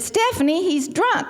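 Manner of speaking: high-pitched retold scolding; hammed-up motherly exasperation; exasperated mother. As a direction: imitate Mama insisting, pitch way up. Mother's retort in acted quarrel.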